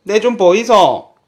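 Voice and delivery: hearty Gyeongsang dialect demo. Shown as a demonstration. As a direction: stretch it out in a broad Gyeongsang dialect. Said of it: "경상도 사투리로 하면".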